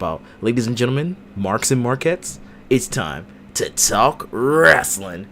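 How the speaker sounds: upbeat wrestling host intro; show host intro. Acted. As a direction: hype the episode like a host. Podcast show intro read.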